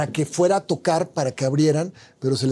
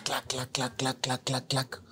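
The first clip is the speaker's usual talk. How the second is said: imitated clacking noise; 2 semitones lower, 10 dB quieter, slower than usual. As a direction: mimic soft repeated clacking from another room. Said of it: "empiezo a oír unos ruidos".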